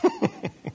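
high, soft giggle; stifled laugh. Real, not acted. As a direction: giggle while trying to hold it in. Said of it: "I'm trying to stop".

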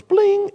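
high, loud "bling" sound effect; magic-spell chime. Retold or imitated. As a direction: imitate a magic zap on a high ringing note. Imitating the wizard's magic transformation.

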